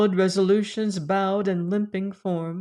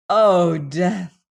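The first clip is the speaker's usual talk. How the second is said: louder, firm call to death; rally the voice and call out; stronger voice; 7 dB louder than usual. A narrator's reading. Dying girl defies death, suddenly louder. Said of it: "And then in stronger voice".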